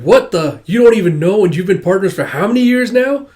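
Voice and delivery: loud incredulous jeer; mocking disbelief. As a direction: needle him loudly. [name] mocks Alma's ignorance.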